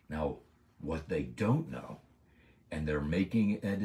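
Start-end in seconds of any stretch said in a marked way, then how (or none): none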